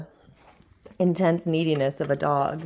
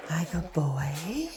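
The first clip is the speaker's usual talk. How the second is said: soft praise to a dog; 6 dB quieter, much slower than usual. Real, not acted.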